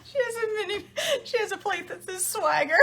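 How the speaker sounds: high-pitched, laughing through the words. Real, not acted. Telling viewers about Kim's swagger plate, laughing. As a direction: giggle while telling it.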